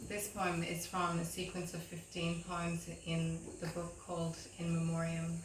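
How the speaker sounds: ordinary talk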